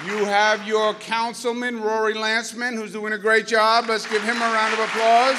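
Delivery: high, loud introduction building to a shout; rousing introduction. Real, not acted. Speaker introduces the councilman for applause, rousing loud call. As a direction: announce a guest over a clapping crowd.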